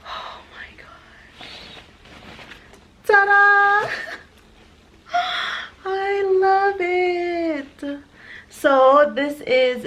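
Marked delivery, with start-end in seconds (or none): 0.1-1.5 breathless whisper. Real, not acted. Opening the bag. 3.0-8.0 much higher, louder ta-da into a slow gasp; thrilled reveal. Real, not acted. Reveals the bag.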